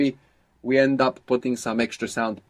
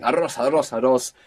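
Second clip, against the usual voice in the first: excited; 2 semitones higher, 3 dB louder than usual. Retold or imitated. Excited jumping, first rolled R.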